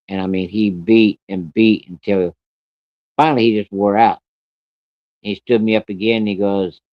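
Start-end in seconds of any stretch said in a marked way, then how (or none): none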